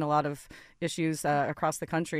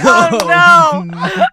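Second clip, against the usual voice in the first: high, loud laughing shriek; laughing shock; 11 semitones higher, 17 dB louder than usual. Real, not acted. Telling friends her blunder, a laughing shriek. Realizing she was propositioned.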